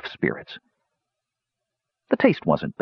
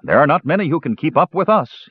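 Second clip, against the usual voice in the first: higher, mildly cocky boast; boastful; 9 semitones higher than usual. A narrator's reading. Sturm to the dwarf over drinks, boastful. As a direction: brag about your drinking. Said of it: "he boasted".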